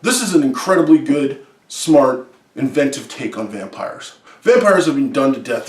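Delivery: ordinary talk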